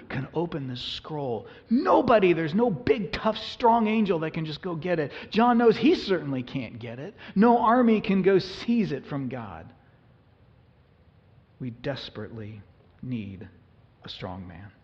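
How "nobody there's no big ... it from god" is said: preaching at full force. Acted. Pastor urges his church toward hope in God, passionately preaching. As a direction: drive it home like a fervent preacher.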